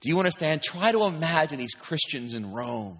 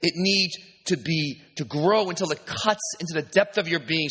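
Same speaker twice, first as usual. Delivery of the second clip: ordinary talk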